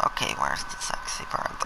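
sneaky whisper. Acted. Player sneaking to find the birds, whispering.

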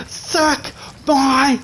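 very high cackling victory yell; triumphant laughing shout. Real, not acted. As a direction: laugh and taunt the loser. Triumphant taunt after kill.

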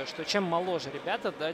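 ordinary delivery

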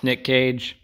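mock computer readout; robot voice. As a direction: say Nick Cage like a search bot. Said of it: "the original Google bot".

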